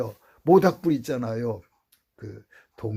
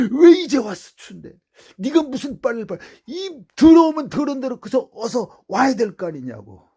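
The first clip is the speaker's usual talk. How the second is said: loud, high scolding mother's voice; 11 semitones higher, 9 dB louder than usual. Retold or imitated. Mother scolding her son for coming home late, loud and high. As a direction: scold him like a worried mother.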